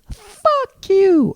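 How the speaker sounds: very high screechy falsetto rant; mock shrieking fury. Imitating the girl cursing Richard before her father, mock shrieking. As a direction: screech it like an outraged girl, hammed up.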